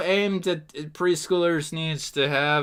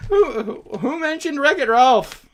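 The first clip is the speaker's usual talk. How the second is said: incredulous; 8 semitones higher, 3 dB louder than usual. Real, not acted. Repeats the baffled question.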